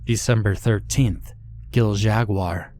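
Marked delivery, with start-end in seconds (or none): none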